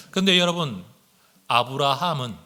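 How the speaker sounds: usual speaking voice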